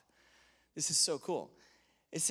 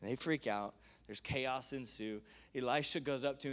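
ordinary delivery

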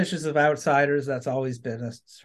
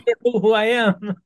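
friendly county local; 7 semitones higher, 6 dB louder than usual. Retold or imitated. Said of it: "they'll be like".

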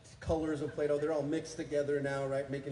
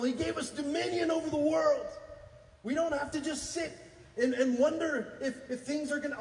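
full-force preaching; 10 semitones higher than usual. Real, not acted. Preacher rallying the church, shouting praise. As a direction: fire up the congregation.

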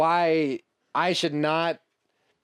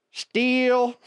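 high, slow, posed Blue Steel delivery; dramatic model voice; 11 semitones higher, 5 dB louder than usual. Pretend. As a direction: strike a pose with the voice. Joking about shipping container.

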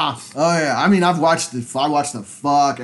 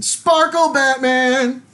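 mock announcer; 10 semitones higher, 4 dB louder, much slower than usual. Joking about sick Robert Pattinson.

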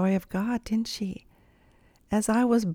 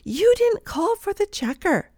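much higher, mock-scolding voice; accusing lady; 12 semitones higher than usual. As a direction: mimic her shrill complaint in a much higher pitch. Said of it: "as she added".

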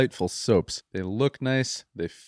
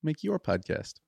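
ordinary delivery

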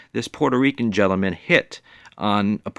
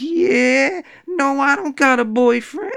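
high, loud, playful answer; flirty reply; 4 dB louder than usual. Retold or imitated. Woman answering the catcaller, high and flirty. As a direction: welcome his question with interest. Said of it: "she turns around and goes".

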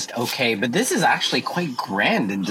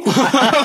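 bright laugh; 11 semitones higher, 6 dB louder than usual. Real, not acted. Laughing at the brisk weather.

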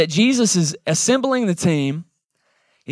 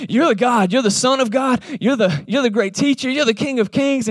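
awed exclaim; 7 semitones higher than usual. Retold or imitated. Nathanael's sudden awed confession.

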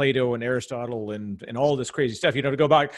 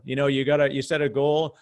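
ordinary delivery

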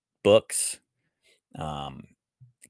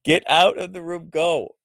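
higher-pitched mock command; imitating jocks; 8 semitones higher than usual. Brother's friends shooing CJ from his room, mock ordering. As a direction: play a jock ordering a kid out. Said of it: "They'd be like".

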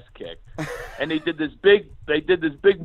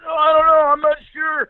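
higher, louder feigned innocence; evasive dad; 13 semitones higher, 5 dB louder than usual. Retold or imitated. Dad dodging his son's question at the game, high and innocent. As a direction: play dumb in a high, cheerful voice. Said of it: "And I'm going".

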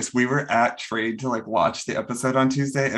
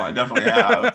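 laughing; 10 semitones higher, 4 dB louder, faster than usual. Real, not acted. Admits it laughing.

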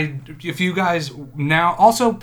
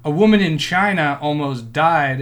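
normal talking voice

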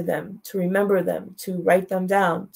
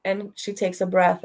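ordinary talk, nothing marked

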